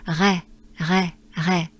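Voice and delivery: "re" com o R francês na garganta; French re. Shown as a demonstration. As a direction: diga "re" raspando o R ao fundo. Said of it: "we're looking for the sound re".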